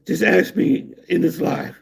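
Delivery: normal talking voice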